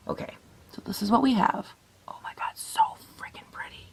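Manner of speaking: soft admiring whisper. Real, not acted. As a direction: whisper it in awe at the look. Admiring her finished eye look.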